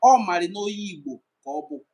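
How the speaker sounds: usual speaking voice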